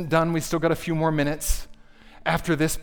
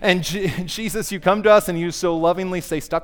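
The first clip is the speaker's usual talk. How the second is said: pastoral prayer; 5 dB louder than usual. Acted. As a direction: lead the room in prayer. Leads closing prayer.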